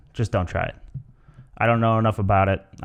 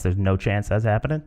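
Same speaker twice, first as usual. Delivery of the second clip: ordinary talk, nothing marked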